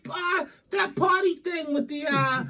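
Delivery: high, shrill-pitched scolding mother; old Italian mom impression. Mocking a friend's Italian mother scolding him, high and outraged. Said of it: "She's like".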